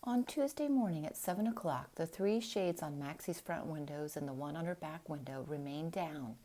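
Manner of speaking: usual speaking voice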